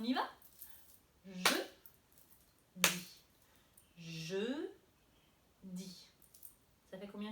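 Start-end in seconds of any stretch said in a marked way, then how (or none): 1.2-3.1 "Jeudi" split in two beats, long gap between; syllable by syllable. Shown as a demonstration. Teacher claps syllables for children, "Jeudi" broken into two beats. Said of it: "nous allons frapper les syllabes de jeudi". 4.0-6.1 "Jeudi" in two detached syllables, first one lengthened. Shown as a demonstration. Teacher repeats clapping for children, "Jeudi" split in two syllables. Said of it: "nous allons frapper les syllabes de jeudi".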